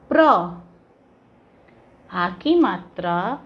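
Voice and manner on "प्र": प्र as one firm, louder syllable; pronunciation demo. Shown as a demonstration. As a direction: pronounce प्र on its own, clearly and loudly. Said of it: "तो हम इसका उच्चारण करेंगे". (प्र का अलग, ज़ोर से उच्चारण)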